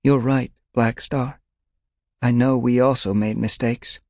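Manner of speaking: sad agreement. A narrator's reading. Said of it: "Dawnpelt nodded sadly".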